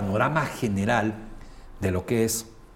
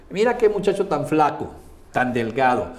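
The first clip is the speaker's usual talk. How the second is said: mocking remark; 2 semitones higher, 3 dB louder than usual. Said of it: "Yo les digo".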